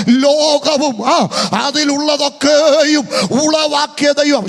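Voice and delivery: loud, drawn-out proclamation; slow, loud call for the crowd to repeat. Shown as a demonstration. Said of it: "ഉറക്കെ പറ". (ഉറക്കെ, നീട്ടി പ്രഖ്യാപിക്കുന്നു)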